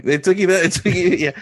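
loud, high ribbing; teasing disbelief. Real, not acted. Teasing co-host over slow sum.

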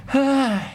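high, drawn-out exasperated cry; heartfelt exclamation. Acted. Host voicing a fan awaiting a comeback, high exasperated cry. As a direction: cry out on behalf of a waiting fan. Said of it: "ใส่ความรู้สึกตัวเองไปด้วย".

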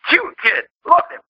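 ordinary talk, nothing marked

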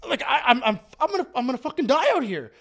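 high, stammering mock panic; acted panic. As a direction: stammer it out as if about to die. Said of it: "I'm just panicking".

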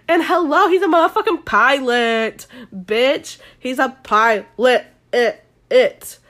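loud, emphatic fangirl gushing; high hype settling into drawn-out emphasis; excited gushing. Real, not acted. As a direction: gush loudly, then stretch out the last word. Gushing over her crush.

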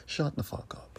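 whispered, dropping to a hiss; hissed dismissal. Real, not acted. Host silences hypocrisy accusers, whispered hiss. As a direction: breathe it out with contempt. Ranting at online critics.

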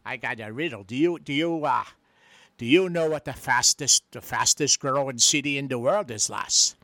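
a lightly voiced stage Irish brogue; a hint of a cheeky Irish charmer; Patio Furniture Irish brogue. Irish visitor flirting with the lovely lass, in a light Irish brogue. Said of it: "It's me, Patio Furniture".

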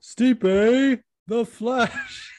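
high, loud, drawn-out nickname announcement; mock announcer proclamation. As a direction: proclaim it like a ring introduction. Proclaiming fighter's new nickname.

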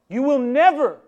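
high, loud, emphatic shout; forceful outburst at full volume; strong refusal. Acted. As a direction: shout it as an absolute ban. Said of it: "the word is strong".

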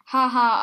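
loud triumphant ha-ha; booming laugh. Acted. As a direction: bellow out a gloating laugh. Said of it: "tönte dieser".

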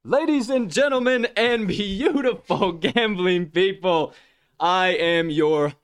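big drawn-out announcer greeting, pitched high. Acted. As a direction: boom it out like a ring announcer. Hype host reintroduction.

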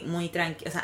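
normal talking voice

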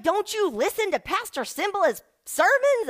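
high hammed-up sassy church lady; church woman's disbelief. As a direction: tease her for even asking. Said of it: "She was like".

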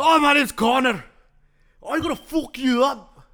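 loud, high mock Irish brogue; Irish accent. Podcast host playing Conor McGregor to cohost, loud mock Irish. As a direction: ham up an Irish fighter taunting. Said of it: "should I be McGregor".

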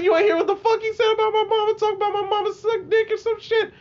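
high, fast, squealing mock outrage; mad as hell. Kid arguing back about insults to his mama, high furious outburst. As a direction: re-enact a furious, rapid high-pitched protest. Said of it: "I was mad as hell".

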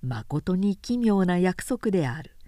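usual speaking voice

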